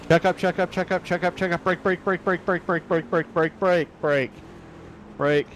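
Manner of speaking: rapid, high, pressing repeats; urgent warning shout. Real, not acted. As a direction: bark the warning fast and high. Spotter warns of a wreck ahead.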